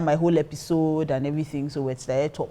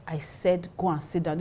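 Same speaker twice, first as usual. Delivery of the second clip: softer, understated command; retold quiet order; 10 dB quieter than usual. Pastor ordering the drunk guy away, retold quiet command.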